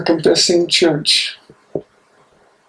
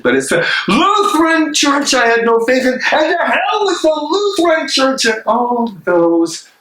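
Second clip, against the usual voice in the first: higher, heated condemnation; rising anger; 9 semitones higher than usual. Acted. Bonhoeffer condemning the Lutheran Church, heated and higher. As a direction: let the fury climb.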